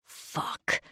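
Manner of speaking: whispered rasp under his breath. Acted. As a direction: hiss it out low and rough. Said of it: "Zane rasps".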